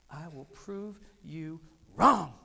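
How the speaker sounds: slow, loud, deliberate vow; determined vow. Retold or imitated. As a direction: swear it to yourself, slowly and hard. Vow crystallized in his mind.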